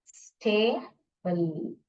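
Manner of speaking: Stable drawn out slowly; slow careful read. Shown as a demonstration. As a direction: sound out stable syllable by syllable. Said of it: "और ये क्या है?".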